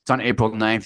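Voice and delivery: usual speaking voice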